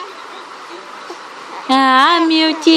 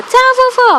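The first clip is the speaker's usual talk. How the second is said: put-on high, sing-song baby talk; baby talk to grandma; 9 semitones higher than usual. Waving bye to grandma for baby. (voz fininha de bebê falando com a vovó)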